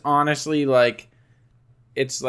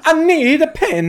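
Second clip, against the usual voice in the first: high, loud hammed-up plea in Italian accent; last-meal mock panic; panicked Italian voice; 6 dB louder than usual. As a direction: beg frantically like a cartoon Italian. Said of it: "there's a serial killer at the door".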